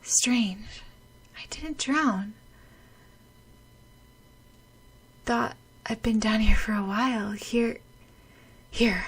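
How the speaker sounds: soft, confused whispering; dazed whisper. Acted. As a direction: whisper in a daze. Dazed underwater.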